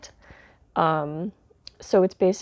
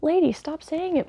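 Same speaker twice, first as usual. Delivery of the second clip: exasperated plea; 11 semitones higher than usual. Retold or imitated. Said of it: "And I'm like".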